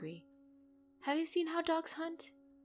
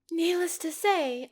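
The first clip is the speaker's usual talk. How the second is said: raised, loud and slowly drawn out; stern; 9 semitones higher, 7 dB louder than usual. Acted. Mafia boss warning her men, stern and loud. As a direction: lay down the law, loud and slow.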